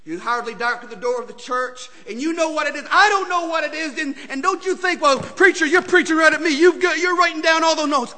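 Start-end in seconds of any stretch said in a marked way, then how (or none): 2.1-8.2 rising, louder preacher cadence; preaching at full force. Acted. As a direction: preach it hard to the congregation.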